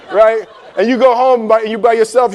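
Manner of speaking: usual speaking voice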